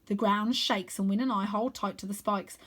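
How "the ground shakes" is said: ordinary delivery, nothing marked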